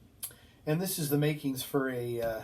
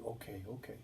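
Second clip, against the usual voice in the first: much softer, drowsy murmur; soft sleepy okay; 4 semitones lower, 14 dB quieter than usual. Retold or imitated. Patient murmurs to the nurse while settling into bed. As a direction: mumble it as if drifting off. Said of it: "every time he'd say".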